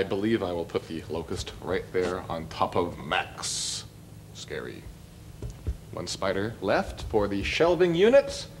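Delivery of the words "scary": low hushed mock spooky word; playful spooky murmur; mock scary. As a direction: hush it like a campfire fright. Placing rubber creatures to scare spiders.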